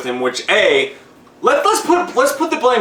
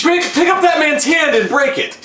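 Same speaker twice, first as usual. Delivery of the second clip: exasperated urging; 10 semitones higher than usual. Pretend. Urges husband to act.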